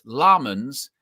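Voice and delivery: Laarmans with a long, stressed LAAR; stress on first syllable. Shown as a demonstration. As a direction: slowly lean on the LAAR of Laarmans. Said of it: "emphasis on the first syllable".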